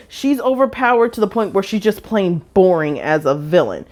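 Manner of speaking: normal talking voice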